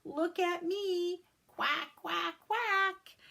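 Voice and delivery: high, slow quacky duck voice; proud duck voice. Acted. Lemon showing off to everyone, high duck voice. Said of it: "quacked as if to say".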